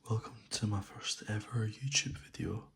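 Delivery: soft ASMR whisper; soothing spa whisper. Real, not acted. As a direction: whisper softly and close to the mic. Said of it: "I'm gonna do this in a soft spoken voice, as this is an A S M R video".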